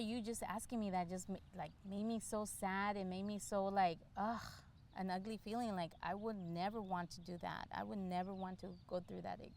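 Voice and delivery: ordinary delivery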